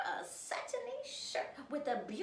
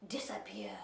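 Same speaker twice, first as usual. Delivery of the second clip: much lower unvoiced whisper; spooky whisper; 6 dB quieter than usual. Godmother warning of the spell, spooky whisper. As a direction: let it vanish in a hush.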